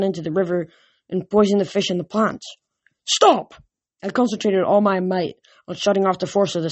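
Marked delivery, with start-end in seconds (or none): 3.0-3.5 high, loud, falling cry; urgent command. A narrator's reading. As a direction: shout it as a desperate order. Desperate repeated command to water.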